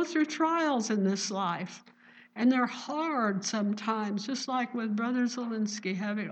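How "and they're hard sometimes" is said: ordinary talk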